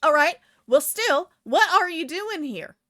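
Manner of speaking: high, loud, insistent voice; indignant woman. Acted. Woman in pink presses the figure, indignant.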